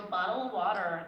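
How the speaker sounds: key phrase in an American accent. Shown as a demonstration. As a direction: say BOTTLE and WATER the American way, T's softened. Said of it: "I only have key phrases".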